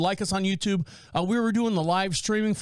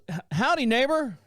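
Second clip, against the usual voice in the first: folksy neighbor greeting; 7 semitones higher than usual. Retold or imitated. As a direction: greet him like a cheery country neighbor. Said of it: "someone comes down the driveway and goes".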